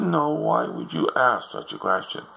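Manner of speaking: a mother taken aback, asking slowly. Acted. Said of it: "She said".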